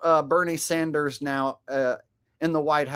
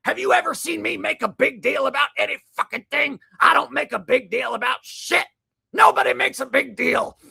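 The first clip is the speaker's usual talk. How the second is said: mock host rant; 8 semitones higher, 5 dB louder than usual. Mocking the clip's host rant.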